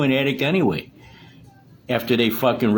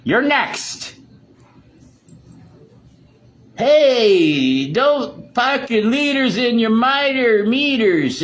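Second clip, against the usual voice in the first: menacing shout; 10 semitones higher, 3 dB louder, much slower than usual. Real, not acted. Threatening Dylan.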